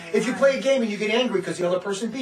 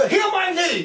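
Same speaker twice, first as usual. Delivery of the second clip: high mocking voice; mocking demand; 11 semitones higher, 5 dB louder, slower than usual. Imitating a challenger demanding healing. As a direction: mimic the guy demanding a cure. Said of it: "he goes".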